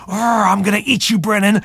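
high, hammed-up growling monster; playful monster growl. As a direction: chase the kid as a silly monster. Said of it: "I just growled right back at him".

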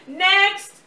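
shouted next; loud call. Acted. Director calling next actor.

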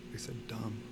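soft unvoiced whisper. Daughter confiding the bad word to dad, in a whisper. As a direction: whisper it like a secret.

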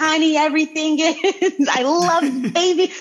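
high, laughing adoration; gushing over babies. Real, not acted. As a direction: gush with delight, pitch lifted. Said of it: "It's like a different [name] shows up".

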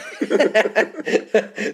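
bursting high laugh. Real, not acted. Laughing at the victim asking if he's okay, high laugh.

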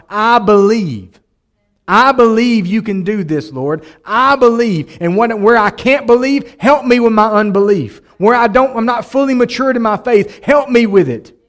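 loud, high pleading cry of faith; impassioned cry. Acted. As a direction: cry it out to God, pleading with everything. Preacher voices a believer's cry.

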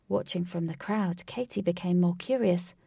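ordinary delivery, nothing marked

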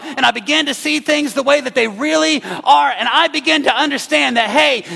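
loud, high preacher build; preaching at full force. Acted. Pastor voicing David's complaint to the church, loud preaching. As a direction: drive David's complaint home. Climax of sermon.

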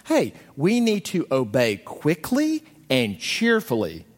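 a mild mock of a chipper parent; lightly voiced parent. Parent on top of things instructs child, slow and chipper. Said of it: "like a parent who feels so on top".

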